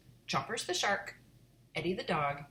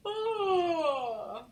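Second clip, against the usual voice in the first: loud yawn; 13 semitones higher, 5 dB louder than usual. Acted. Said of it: "I yawned loudly".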